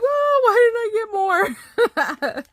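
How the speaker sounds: high, drawn-out mock wail; exaggerated fake sobbing whine; mock crying. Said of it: "I come home crying like".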